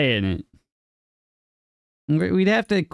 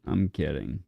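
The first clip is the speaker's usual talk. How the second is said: murmured aside; 13 semitones lower, 8 dB quieter than usual. Real, not acted.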